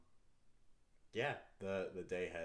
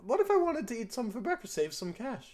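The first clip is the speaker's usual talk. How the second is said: put-on higher, louder, fast childish protest; arguing kid; 14 semitones higher, 7 dB louder than usual. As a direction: daughter pleading to save food. Daughter argues about the meat.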